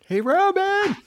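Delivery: high, loud mock call; imitated holler. Host mimics guest calling the gardener, playful holler. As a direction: mimic someone yelling for the gardener. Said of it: "I like the Ruben".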